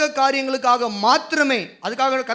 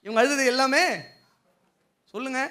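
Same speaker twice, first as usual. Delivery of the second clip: usual speaking voice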